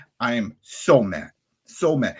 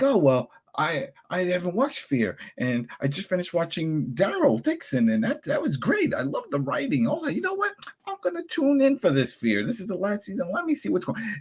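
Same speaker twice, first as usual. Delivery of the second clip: high, mock-eager fan voice, much higher; mock casual viewer; 6 semitones higher than usual. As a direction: parody a naive new viewer, pitched way up. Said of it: "for people that are like".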